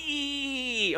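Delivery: high, tense held "eee"; tight "ee". Shown as a demonstration. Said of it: "one's really tight".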